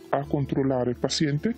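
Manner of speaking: ordinary talk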